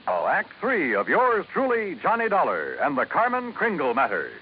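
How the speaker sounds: ordinary talk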